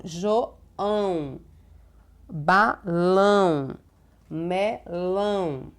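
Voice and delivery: JOÃO, BALÃO, MELÃO split into drawn-out syllables with a nasal ão; nasal ending demo. Shown as a demonstration. Teacher showing pupils the tilde's sound, slow nasal ÃO in João, balão, melão. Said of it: "o som sai tanto pela boca quanto pelo nariz".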